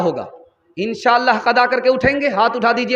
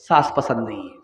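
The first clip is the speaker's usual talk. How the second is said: normal talking voice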